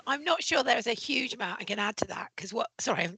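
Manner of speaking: a strained, half-whispered croak; croaky voice. Real, not acted. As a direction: voice cracking after a long silence. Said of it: "I've got croaky vo-, voice now".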